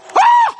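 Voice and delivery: very high, loud shriek; mock startled scream. Woman denounced before the whole plane, mock startled screams. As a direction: imitate a woman shrieking in shock.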